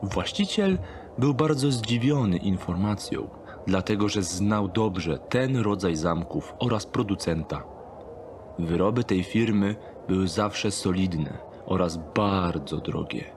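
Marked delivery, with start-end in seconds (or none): none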